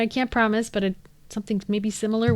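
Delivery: ordinary talk, nothing marked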